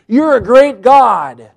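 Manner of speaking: slow, loud praise of God; slow, loud pleading praise. Acted. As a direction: lift God up slowly and fervently. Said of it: "He's pleading".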